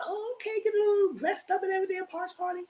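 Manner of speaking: high put-on fancy tone; mock posh voice. Recalling her reaction to the posh party, high and put-on. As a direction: play it up as fancy.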